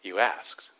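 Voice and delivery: soft, low, simple reply; quiet answer. Retold or imitated. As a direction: say it gently and plainly, as God's quiet answer. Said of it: "his answer was simple".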